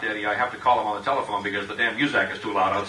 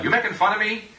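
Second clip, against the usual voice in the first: Pat's catchphrase; 9 semitones higher than usual. Retold or imitated. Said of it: "the phrase is".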